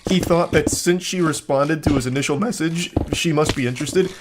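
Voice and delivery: ordinary talk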